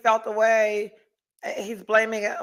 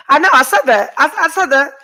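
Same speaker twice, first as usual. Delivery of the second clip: loud, higher, playful insistence; laughing protest; 4 semitones higher, 7 dB louder than usual. Real, not acted. Woman answering a viewer about hot flashes, loud playful protest. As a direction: insist over them, on the edge of laughter.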